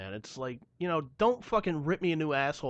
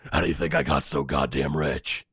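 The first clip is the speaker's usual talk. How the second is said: Batman; 11 semitones lower, 3 dB louder than usual. Pretend. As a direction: growl it like the caped crusader.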